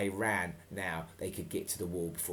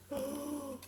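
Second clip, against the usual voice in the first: gasp; 6 dB quieter than usual. Acted. Reader gasps at Scott's death.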